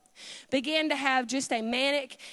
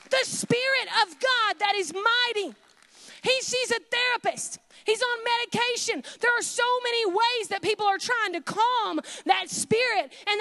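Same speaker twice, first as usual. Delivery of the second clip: preaching at full force; 5 semitones higher than usual. Real, not acted. Preacher proclaiming God's power over the boy, fervent. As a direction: preach it out to the church.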